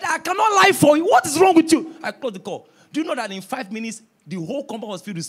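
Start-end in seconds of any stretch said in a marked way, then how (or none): 0.0-1.9 loud shouted refusal, higher; angry refusal. Retold or imitated. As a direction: shout it back in outrage. Pastor refusing bribe, shouting.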